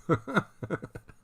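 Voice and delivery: sudden high laugh; high burst of laughter. Real, not acted. Laughing at chat joke.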